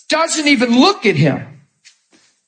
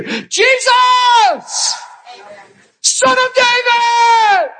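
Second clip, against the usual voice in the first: yelling out; 12 semitones higher, much slower than usual. Acted. Bartimaeus yelling to Jesus over the crowd, full-voiced. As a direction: cry out over the whole crowd. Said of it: "And he yelled above all the chitter chatter".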